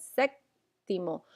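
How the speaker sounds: séptimo said slowly, SÉP- stressed clearly; teacher sounds out séptimo slowly, hitting the first syllable; stress on the accent. Shown as a demonstration. Teacher showing students the accent, slow clear séptimo. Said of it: "because the accent".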